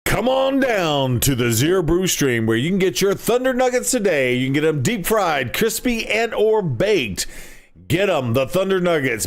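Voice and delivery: radio ad voice. Pretend. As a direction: hype it like a radio ad. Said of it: "I'm gonna turn on the radio voice".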